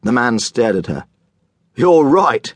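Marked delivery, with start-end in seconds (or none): 1.7-2.5 sudden loud realization; exclaiming. Acted. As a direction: burst out with relief. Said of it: "he exclaimed".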